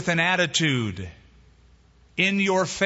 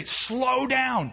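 high-pitched, urgent plea to a horse; panicked command; 8 semitones higher than usual. Acted. Rider groping for the right word, high panicked command. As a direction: try the command in rising panic. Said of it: "Well, he panicked".

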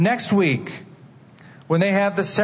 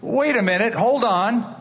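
higher, urgent alarmed pleading; alarmed protest; 7 semitones higher than usual. Acted. Father protesting as doctors grab his son, higher alarmed plea. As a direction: stop them before they take your boy.

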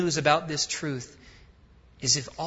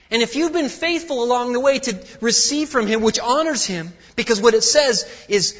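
loud, high, driving sermon voice; full-force preaching; 8 semitones higher, 7 dB louder than usual. Acted. Preacher to church, loud and driving. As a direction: preach it out to the congregation.

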